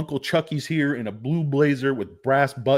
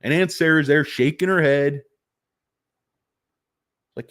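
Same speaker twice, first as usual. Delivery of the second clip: ordinary talk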